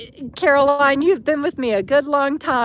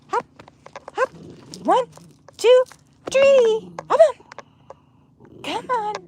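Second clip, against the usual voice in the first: rising, eager count to three; counting him up; 11 semitones higher than usual. Real, not acted. Owner counting so her dog will jump up.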